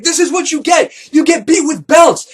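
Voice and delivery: high, loud shouted threat; furious shout. Retold or imitated. Ace shouts at the narrator while beating him, furious. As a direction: yell while swinging a belt.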